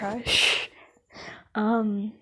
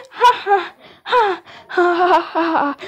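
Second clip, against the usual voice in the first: forced laugh; 6 semitones higher, 8 dB louder than usual. Acted. Flustered fake laugh after being recognised.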